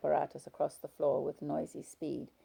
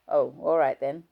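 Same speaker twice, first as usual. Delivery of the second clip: grudging husband; 10 dB louder than usual. Acted.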